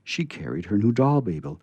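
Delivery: usual speaking voice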